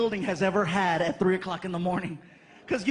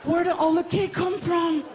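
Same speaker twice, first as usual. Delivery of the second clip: put-on high, louder child voice; kid's question; 8 semitones higher, 4 dB louder than usual. Frankie seeing the cakes, high excited kid voice. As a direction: ask like an amazed little boy. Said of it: "and he's all".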